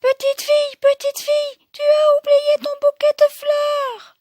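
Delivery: put-on very high Baby Bear squeal; tiny calling-out voice. Baby Bear calling after the fleeing girl, tiny high cry. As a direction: call after her in a teeny squeak. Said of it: "lui crie de sa toute petite voix".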